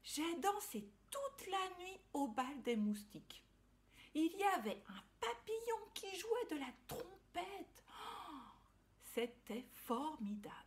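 high, breathless little ladybug; gushing tiny insect, thrilled; excited ladybug voice. Acted. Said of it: "répondit-elle".

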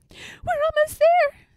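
loud, very high bird voice; shrill storybook sparrow call. A narrator's reading. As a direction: call out like little birds, stretched. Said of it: "they call".

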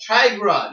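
Tigra in two syllables, high and loud; corrected name. Real, not acted. Correcting the cat name, Tigra in two syllables. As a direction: say Tigra emphatically, no vowel between g and r. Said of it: "not Tigera, it's Tigra".

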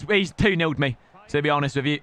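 normal talking voice

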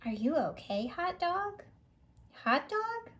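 usual speaking voice

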